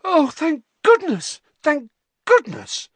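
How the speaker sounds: high, loud, drawn-out cry of relief; relieved cry. A narrator's reading. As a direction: cry out shrilly with huge relief. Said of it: "a cry echoed along the damp lane".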